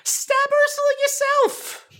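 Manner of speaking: a squeaky hyped-up fan urging the heroine on; mock cheerleading Ariel. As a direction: cheer her on like a coach at a game.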